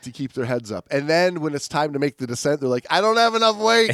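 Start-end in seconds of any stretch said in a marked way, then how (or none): 2.9-3.9 high, louder whiny complaint; mock diver complaint. A mock whine from a diver who can't descend. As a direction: play the sinking diver's whine.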